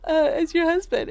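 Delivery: high, loud, emphatic declaration; imitating proud dad. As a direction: proclaim it like an excited, proud husband. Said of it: "I'm like".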